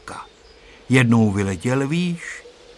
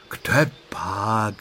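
understated earnest denial; a touch of solemnity; serious; 3 semitones lower than usual. A narrator's reading. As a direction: answer gravely, with mild seriousness. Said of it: "odvětil vážně Honzík".

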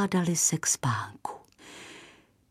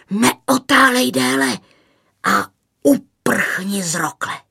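raised, louder pitch on the command; urgent warning; 6 semitones higher, 5 dB louder than usual. A narrator's reading. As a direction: urge him to flee without delay. Old man urges a quick escape. (naléhavě, zvýšeným hlasem)

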